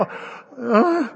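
high, loud wordless stammer; dumbfounded. Acted. Pastor replying to his amazed friend, dumbfounded.

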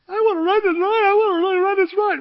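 put-on high, loud wailing little girl begging; crying little girl. Little girl sobbing to him at the ride. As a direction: wail like a heartbroken child who can't ride. Crying child voiced in story.

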